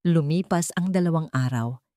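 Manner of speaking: ordinary delivery, nothing marked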